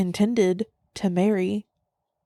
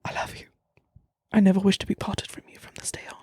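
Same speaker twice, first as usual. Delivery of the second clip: whispered, halting declaration of love; Darcy; much faster than usual. Acted. Darcy declaring his love to Lizzie, hushed whispered impression. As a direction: whisper it haltingly, then hurry through the vow. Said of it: "You do a good Darcy".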